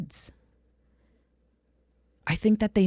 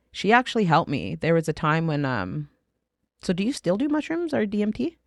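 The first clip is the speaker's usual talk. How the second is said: ordinary talk, nothing marked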